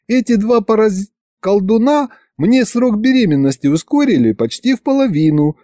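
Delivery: high-pitched explaining wife; reassuring wife. A narrator's reading. Wife explaining the shortened pregnancy to husband, reassuring.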